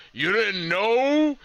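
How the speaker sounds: imitated catchphrase, stretched out and rising high; Road Dogg. Podcaster quotes the wrestler's gimmick, imitated hype call. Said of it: "Road Dogg's gimmick, the whole, like".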